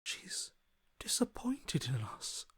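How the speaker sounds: fading into a slow whisper; a hushed, slow warning. Acted. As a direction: let it sink to a breathless whisper. Whispered grim news.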